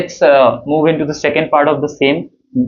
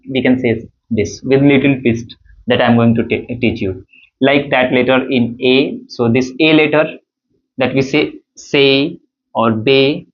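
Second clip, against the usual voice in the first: usual speaking voice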